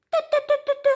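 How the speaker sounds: very high squeaky mouse tease; tut-tut like a tiny mouse; teasing mouse. Acted. Mouse teases the wolf in a tiny squeaky voice. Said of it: "dit Souris".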